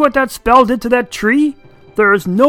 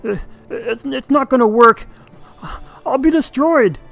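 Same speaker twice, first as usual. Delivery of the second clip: very high, loud frightened dragon; 13 semitones higher, 8 dB louder than usual. Acted. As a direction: whimper it out in fear. Hubert frightened, refusing.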